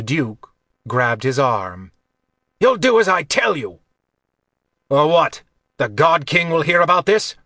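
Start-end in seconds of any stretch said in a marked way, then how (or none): 2.6-3.8 loud, high-pitched outburst; angry command. A narrator's reading. Staged quarrel for the guards. 4.9-7.4 loud, raised, indignant retort; defiant challenge. A narrator's reading. Staged quarrel for the guards.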